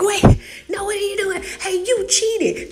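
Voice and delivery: high-pitched, indignant mock protest; mock outrage. Racer calling out the sister who took off early, mock outrage. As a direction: cry foul in a high voice.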